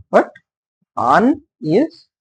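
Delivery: ordinary talk, nothing marked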